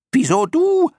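very high, loud squeal; indignant clown moths. A narrator's reading. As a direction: squeal the challenge. Moths challenge the first one. (empört und sehr hoch quietschend)

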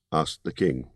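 ordinary delivery, nothing marked

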